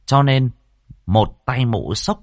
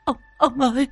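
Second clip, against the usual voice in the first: very high, terrified wail of the wife; stammer, then wail in horror; panicked high-pitched cry to her husband; shrieking; 3 dB louder than usual. A narrator's reading. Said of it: "Bà Hợi rú lên một tiếng".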